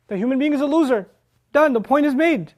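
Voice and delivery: high, emphatic pronouncement; blunt verdict. Acted. Teacher voicing a would-be final statement, high and emphatic.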